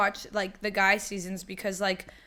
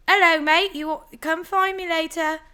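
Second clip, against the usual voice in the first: hammed-up British accent, pitched higher and louder; nervous put-on British accent while chatting someone up; 8 semitones higher, 4 dB louder than usual. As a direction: play a cheeky flirt in a fake British accent. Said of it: "start talking in a British accent".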